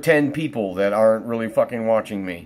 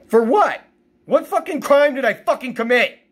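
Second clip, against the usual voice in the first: high-pitched, indignant yelling; real anguished outrage; outraged protest; 12 semitones higher than usual. Real, not acted. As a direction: demand an answer, voice shooting up. Protests suffering for no reason.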